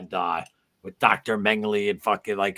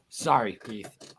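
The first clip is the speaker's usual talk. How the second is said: ordinary talk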